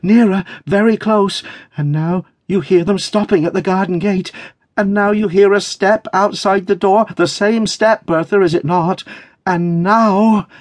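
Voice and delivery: high, mounting anticipation; panting excitement. A narrator's reading. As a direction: count down the approach in a high, thrilled voice. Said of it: "she panted".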